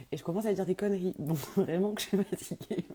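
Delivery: giggly with tiredness, voice lifted; laughing at herself. Real, not acted. Admitting to talking nonsense when tired, laughing.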